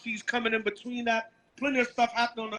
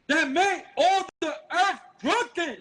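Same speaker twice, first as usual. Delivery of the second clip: high, slow, ringing scripture reading; street-preaching Bible reader; scripture declamation; 7 semitones higher, 4 dB louder, slower than usual. Acted. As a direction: proclaim each phrase slowly, pitched up.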